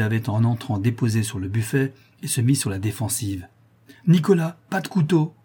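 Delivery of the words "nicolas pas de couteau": high-pitched warning call; raised, insistent command; urgent repeated cry. A narrator's reading. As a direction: call out sharply to stop him. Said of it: "répéta la veuve".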